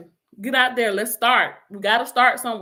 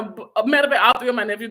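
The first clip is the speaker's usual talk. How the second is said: usual speaking voice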